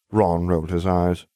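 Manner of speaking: ordinary delivery, nothing marked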